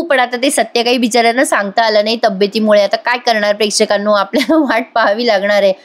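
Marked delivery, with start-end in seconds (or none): none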